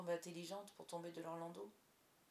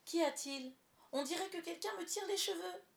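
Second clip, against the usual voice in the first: high, loud startled yelp of complaint; crying out; 10 semitones higher, 5 dB louder than usual. A narrator's reading. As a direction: cry out high as if hurt. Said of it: "elle poussa un cri".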